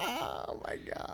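breathless whispered oh my God. Real, not acted. Friend stunned by the joke, whispering. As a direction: whisper it through the laugh.

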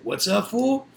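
imitated challenge. Storyteller quoting the guy behind them on the street, mock taunting. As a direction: mimic someone picking a fight. Acts out rival confronting them.

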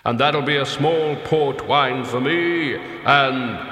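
loud, slow, booming order; heavy leaden voice. A narrator's reading. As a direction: boom it out like lead striking rock. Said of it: "hitting a rock with a roll of sheet lead".

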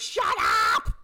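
sudden yelled outburst, imitated; mock yelling. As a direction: yell it like a woman at breaking point. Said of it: "why are you yelling".

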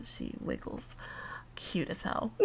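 hushed aside, barely voiced; whispering. Real, not acted. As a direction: whisper it so the dog stays calm. Aside about her wiggling dog.

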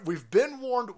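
normal talking voice

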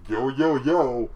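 slow, loud hype-man drawl; loud, slow poser rapper impression; wannabe rapper voice.

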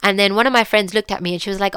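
normal talking voice

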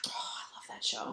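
hushed, whispered adoration; real whispered fondness; fond murmur. Real, not acted. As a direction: sigh it out softly and slowly. Sighing fondly over a favorite show.

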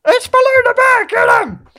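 high, full-voiced cartoon yell; hammed-up panicked battle cry; frantic shouting. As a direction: scream a warning across the jungle. Acted fight cries from the film.

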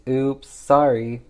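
mock sheepish apology. Toto brushes off refund demand.

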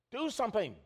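high, urgent plea to wake him; panicked plea. Retold or imitated. Frightened disciples waking the sleeping Jesus, high and urgent. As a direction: disciples begging in the storm.

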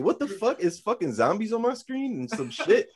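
high-pitched, incredulous retold outburst; mock bewilderment. Fan recounting coming back to zombies on screen, high incredulous voice. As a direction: act out baffled disbelief, pitch jumping up. Retelling his startled reaction to zombies.

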